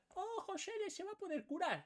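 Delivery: playful alarm; mock alarm. Real, not acted. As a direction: sound caught off guard. Alarm at enemy healing.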